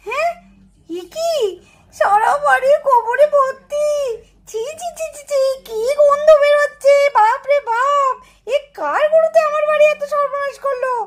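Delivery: high, drawn-out cry of dismay; outraged wailing. Acted. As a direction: wail in disgust and outrage. Finds house and yard fouled by cows. (বিরক্তিতে হাহাকার করা গলা)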